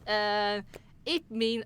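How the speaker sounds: usual speaking voice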